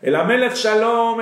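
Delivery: loud, high call; shouting from afar. Retold or imitated. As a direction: call out across the distance. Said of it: "viene y grita".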